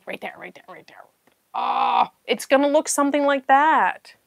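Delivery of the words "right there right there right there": whisper. Real, not acted. Finding puzzle spot.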